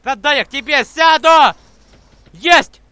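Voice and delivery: loud pleading yell, then a cheer; excited shout. Real, not acted. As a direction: shout to be let in. Begging for a seat, then cheering.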